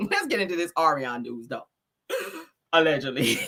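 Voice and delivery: normal talking voice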